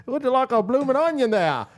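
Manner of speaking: high, hammed-up Aussie accent offering a blooming onion; mock Australian server. Imagined Outback server pitching an onion, mock Australian accent. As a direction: play an Outback waiter with a put-on Aussie lilt, pitched well up. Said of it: "they must put out an ad looking for Australians".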